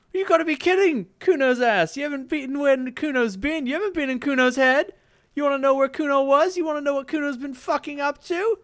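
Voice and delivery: put-on high, loud taunting kid; a cocky brat mocking the cop; bratty kid Kuno. Kid taunts the detective, high and loud.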